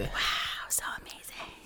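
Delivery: breathy mock-awed whisper; teasing whisper. Real, not acted. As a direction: whisper it with sarcastic wonder. Mock awe at "amazing David.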